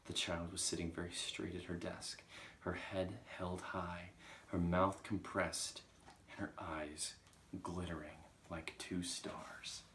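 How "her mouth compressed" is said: ordinary delivery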